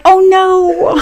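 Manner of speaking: high, laughing mock "oh no" of dismay; mock parental dismay. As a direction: groan it in playful embarrassment. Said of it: "and you're like".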